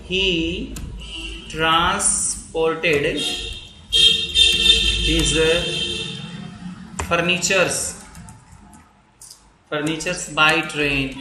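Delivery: each word slow and spaced, with long pauses; slow dictation. Shown as a demonstration. Teacher dictating a sentence, slow and spaced. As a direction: spell it out for students to write.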